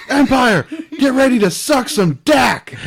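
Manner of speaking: high loud hammed-up yell; mock shout. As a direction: shout it like a cocky fool charging in. Retold cocky character shouting.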